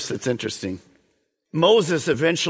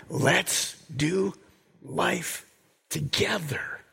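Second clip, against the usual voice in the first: soft and slow, fading to a whisper; a loving invitation, dropping to a breath at the end; tender voice of God; 4 dB quieter, slower than usual. Acted. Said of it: "the great I Am in the house saying".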